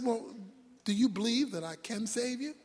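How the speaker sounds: calmly understated questioning, soft; voice from heaven. Acted. God to the hanging man, soft understated question. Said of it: "The voice said".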